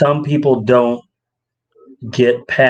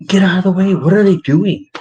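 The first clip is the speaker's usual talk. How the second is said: irritated outburst; 7 semitones higher than usual. Retold or imitated. Retelling his Walmart frustration to his wife, irritated and higher. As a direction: snap at the shoppers blocking the aisle. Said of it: "And I'm like".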